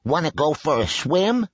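high-pitched duck character voice; storybook duck. A narrator's reading. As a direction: ask as a quacking duck. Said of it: "called the duck".

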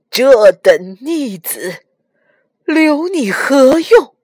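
slow, loud condemnation; bitter hatred. A narrator's reading. Li Jing raises his sword at Nezha, bitterly hating. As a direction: draw it out forcefully with a father's resentment. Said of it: "李靖恨啊".

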